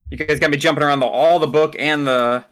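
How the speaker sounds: usual speaking voice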